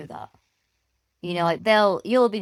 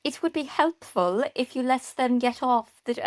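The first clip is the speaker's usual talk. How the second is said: put-on higher, slow, botched German accent; attempted German accent; 5 semitones higher than usual. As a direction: try a German accent that comes out posh British. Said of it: "I'm gonna do her accent".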